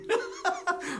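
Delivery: very high, loud peal of laughter; amused laugh. Real, not acted. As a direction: burst out laughing at yourself. Laughs at daughter begging him stop.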